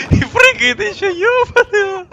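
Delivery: loud high laughing yell; laughing shout. Real, not acted. Laughing at the game. (крик сквозь смех)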